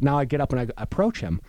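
normal talking voice

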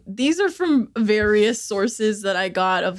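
usual speaking voice

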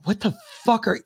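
mock losing your cool, voice climbing; about to lose it. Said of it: "I was about to lose my shit".

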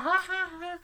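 put-on very high childish giggle; cute toddler giggle. Cute toddler Gage giggles, high childish voice. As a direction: giggle like a sweet little boy. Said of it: "he's like".

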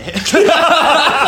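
loud, high cackling laugh; burst of laughter. Real, not acted. Contestant cracks up at failed accent, high loud laughter. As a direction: crack up helplessly at your own attempt.

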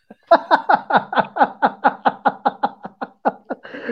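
high, loud cracking-up laugh; loud burst of laughter. Real, not acted. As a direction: burst out laughing at your own slip. Laughs at own fumbled reading.

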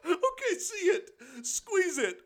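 high-pitched laughter through words; crying with laughter; laughing hard. Real, not acted. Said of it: "hardest I've laughed".

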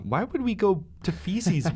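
high, slow mock outrage; mock disbelief. To friends scoffing at feces, high mock disbelief. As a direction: act scandalized at the suggestion.

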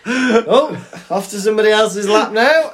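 high, loud, half-laughing; mock surprise. Child being passed to another lap, high mock surprise. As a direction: play the startled child being moved along.

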